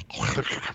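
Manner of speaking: imitated guttural rasp, as if about to spit; Welsh throat sound. Joking about how Welsh speakers sound, imitated guttural hawking noise. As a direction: scrape a soft throaty "ch" noise. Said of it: "they always sound as though they're about to spit all over you".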